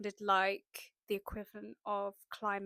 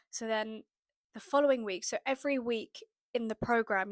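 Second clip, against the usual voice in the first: ordinary delivery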